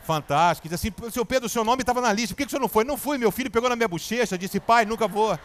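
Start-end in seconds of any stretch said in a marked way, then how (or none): 1.1-5.4 higher, louder excited interview voices; playing out a TV interview in his head, excited; imagined TV interview. Picturing himself on Fantástico, higher and louder. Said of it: "Eu já me vi dando entrevista".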